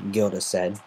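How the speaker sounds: plain reading voice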